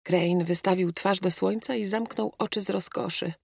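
ordinary delivery

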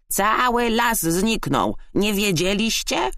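high, slow crow voice; cawing crow. A narrator's reading. Crow breaking news of vanished forest, high caw. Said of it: "zakrakała wrona".

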